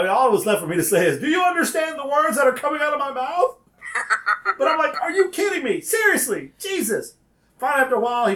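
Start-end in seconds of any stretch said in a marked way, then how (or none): 1.2-3.6 high-pitched mock exasperation, without shouting; exasperated disbelief. Acted exasperation at employee. 5.0-7.1 high-pitched, incredulous retold protest; can't believe what you're hearing; exasperated disbelief. Acted disbelief during phone quarrel.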